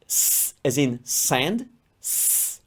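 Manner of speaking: long hissed "S", as in "sand"; drawn-out S sound. Shown as a demonstration. Italian teacher showing learners the first sound of S, long hissed "S". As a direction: hold the "S" as a pure voiceless hiss. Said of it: "S consonant is tricky because it has two sounds".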